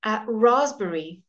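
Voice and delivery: raspberry said slowly, no p sound; silent p. Shown as a demonstration. Teacher modelling the silent p, slow and clear. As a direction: say raspberry as razberry, drop the p. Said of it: "we very seldom have it as a silent P".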